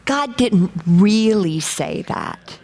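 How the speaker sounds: slow, sly doubting voice, drawn out; sly accuser. Retold or imitated. The enemy whispering doubt to a believer, slow and sly. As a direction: lean in like a sneering tempter. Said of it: "here's my favorite".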